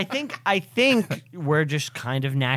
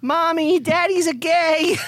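mock child voice; 13 semitones higher, 3 dB louder, slower than usual. Mocking the recital as a child.

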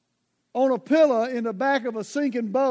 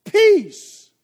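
"Peace" belted out high and loud; thundering command; 8 semitones higher, 11 dB louder than usual. Acted. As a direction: command the storm to stop. Jesus commanding the storm.

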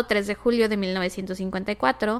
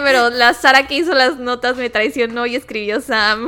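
laughing; 3 semitones higher, 7 dB louder than usual. Real, not acted. Laughing at the notes mistake.

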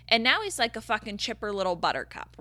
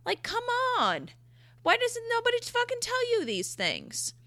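much higher, mock outraged; exasperated squeal. Venting to her viewers about nobody explaining massages, high-pitched. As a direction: squeak out the complaint.

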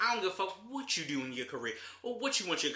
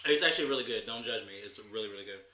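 ordinary talk

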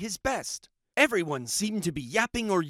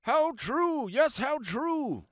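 higher, slow kangaroo; big kangaroo voice; 6 semitones higher, slower than usual. Acted. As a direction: concede it slowly. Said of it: "said the big kangaroo".

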